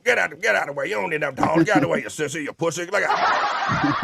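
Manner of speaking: mock the tough old relative; lightly voiced bossy elder; gruff older relative. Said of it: "you always got the older, uh, relative to come".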